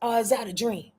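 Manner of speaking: usual speaking voice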